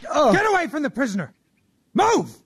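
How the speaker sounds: high barked shout; shouting soldier. Acted. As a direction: chase him off with a yell.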